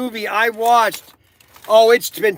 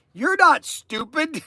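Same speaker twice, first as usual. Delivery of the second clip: slow, high mock-earnest reply; deadpan husband; 7 semitones higher, slower than usual.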